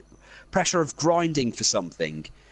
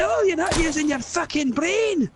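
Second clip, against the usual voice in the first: higher, shouting Scottish voice; Scottish yell; 13 semitones higher than usual. Acted. Scottish character yelling at the ring bearer to throw it, higher. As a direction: order it into the lava at full cry.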